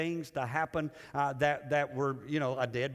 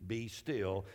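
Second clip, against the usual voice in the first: calm rebuke; 7 semitones lower, 6 dB quieter, much slower than usual. Acted. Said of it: "shakes his head and goes".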